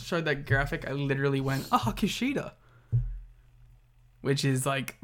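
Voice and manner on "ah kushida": pitched-up, mock-pitying "aw"; retold sad sigh. Reacting to Kushida on the graphic, retold sad sigh. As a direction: act out a small, sad reaction. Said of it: "the saddest thing".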